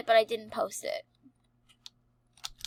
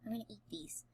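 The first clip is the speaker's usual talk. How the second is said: whispering; 15 dB quieter, much faster than usual. Real, not acted. Kid whispering to camera.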